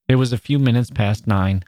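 usual speaking voice

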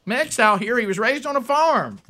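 high-pitched mock-approving country voice; hammed-up high Southern voter; gullible Southern voter. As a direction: gush like a won-over voter. Mock voters taken in by Southern act.

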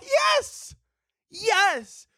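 very high, drawn-out yes; squealed, insistent answer; emphatic admission. Real, not acted. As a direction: cry it out in comic self-defense. Emphatic answers to own questions.

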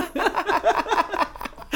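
shrieking high laugh; high burst of laughter. Real, not acted. Host cracking up at his own story, high laugh. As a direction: let out a high helpless laugh.

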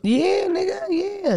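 high excited squeal. Real, not acted. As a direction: squeal with delight. Excited shriek agreeing.

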